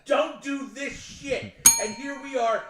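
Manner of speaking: loud, high exasperated rant; slow down and punch the words in outrage; Dennis Leary. Real, not acted. Co-host scolding his pal over ignored movie warnings, ranting. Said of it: "All right, Dennis Leary, calm down".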